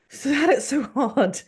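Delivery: high, slow mock pity; mock his hardship in a drawn-out whine; mocking sympathy. Child mocking father's hard life, high sarcastic pity.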